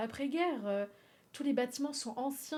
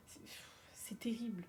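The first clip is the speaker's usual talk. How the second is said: whisper; 10 dB quieter than usual. Real, not acted. Whispered dismay.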